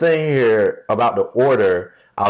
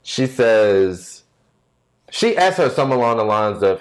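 ordinary delivery

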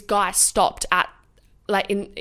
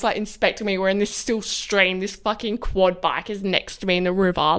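usual speaking voice

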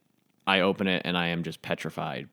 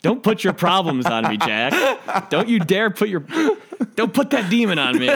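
mock protest; 10 semitones higher, 5 dB louder than usual. Mock quarrel with the Lord.

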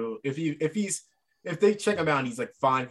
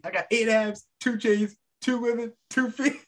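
cocky wrestler promo; 8 semitones higher than usual. Retold or imitated. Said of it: "He's like".

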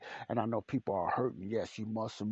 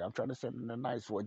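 ordinary talk, nothing marked

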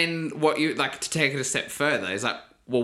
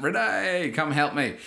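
high, drawn-out cry for help; panicked call; 5 semitones higher, slower than usual. Retold or imitated. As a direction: call out to partner in a retold mild panic. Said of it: "I can hear the panic in your voice".

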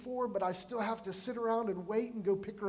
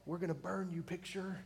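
hushed, much softer mock menace; a dry, muttered threat at a harmless picture; 3 semitones lower, 8 dB quieter than usual. Preacher threatening a fallen picture, quiet mock menace.

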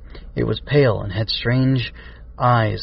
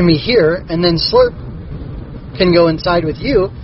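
higher character voice for Chirp; raised character voice; 7 semitones higher, 3 dB louder than usual. A narrator's reading.

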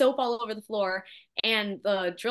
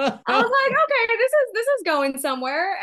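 mock eager; 10 semitones higher, 6 dB louder, slower than usual. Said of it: "I was like".

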